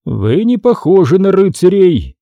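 a touch of thoughtfulness; musing chief. A narrator's reading. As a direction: muse aloud as a chief sizing up strangers. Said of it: "задумчиво произнёс он".

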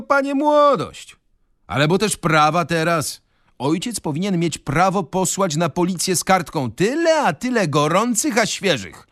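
wysoki, mentorski głos starego urzędnika; pompatyczny urzędnik. Acted. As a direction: graj zadowolonego z siebie moralizatora. Urzędnik's long speech voiced. (urzędnik poucza przy kolacji, wysoko i mentorsko)

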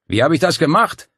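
grinning boast; proud Lorenzo. Acted. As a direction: fish for praise.

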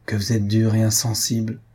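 plain reading voice

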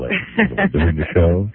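ordinary talk, nothing marked

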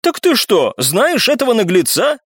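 high, indignant cry; exclaiming. A narrator's reading. Viscount to the girl about the upstart, crying out. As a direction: demand it in astonishment. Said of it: "вскричал виконт".

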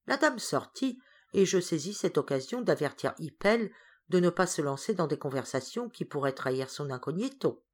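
plain reading voice

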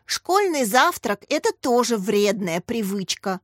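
ordinary delivery, nothing marked

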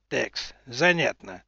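slow, low, musing; slow thoughtful drawl. Acted. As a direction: draw it out while thinking. Адмирал задумчиво тянет. (задумчиво, медленно)

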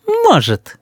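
put-on high, snide little-boy retort. A narrator's reading. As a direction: needle them in a child's high voice. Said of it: "ехидно сказал Рис".

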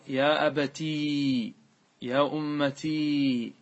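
slow, with drawn-out "ii" ending أبتي and أمتي; incorrect long ya. Shown as a demonstration. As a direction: stretch them: "abatiii", "ummatiii". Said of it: "هذا لا يقال".

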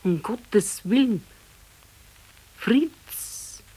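slow, dismayed cry ending up high; slow gasp of dread, rising on the name; horrified. Acted. Wife finds her husband motionless, slow horrified cry.

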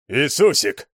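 loud, high bellowed name; bellowing roar. A narrator's reading. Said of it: "заревел Мол".

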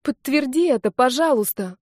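high, urgent begging; crying out plea. A narrator's reading. Redhead begs Sasha to confirm her story, crying out. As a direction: beg for confirmation, voice lifted. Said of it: "выкрикнула рыжая".

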